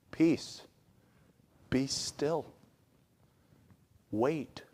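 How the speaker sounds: slow, softened, spaced-out words; calm reassurance. Acted. God answering people who are freaking out, calm. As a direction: settle a panicked crowd, pausing after each command.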